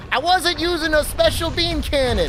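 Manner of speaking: a light Bronx Italian denial; Bronx Italian accent. Retold or imitated. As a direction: play the Bronx Italian streamer protesting. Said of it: "talking in his Bronx Italian accent".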